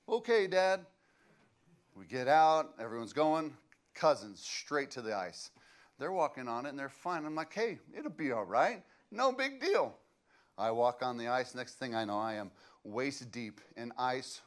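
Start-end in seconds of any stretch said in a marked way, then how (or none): 0.1-0.9 put-on a boy's quick, higher "Okay, Dad."; eager kid reply. Boy promising dad on hunting trip. 7.5-10.0 put-on carefree boy, pitched way up; cocky kid voice. Boy brushing off dad's ice warning.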